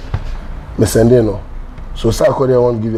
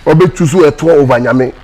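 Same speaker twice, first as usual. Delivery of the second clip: mocking outrage; 5 semitones higher, 3 dB louder than usual. Mocking a pastor's claim.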